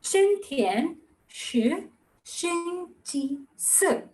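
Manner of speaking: very slow, word by word; slow demonstration. Shown as a demonstration. Teacher showing learners how to repeat the sentence, going slow. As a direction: draw out each syllable for learners to follow. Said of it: "Go slow".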